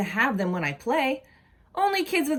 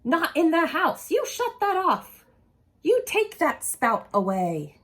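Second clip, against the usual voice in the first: raised, stern commands; mock scolding mother; 6 semitones higher than usual. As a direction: bark it like a cross mom. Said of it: "just what she would say".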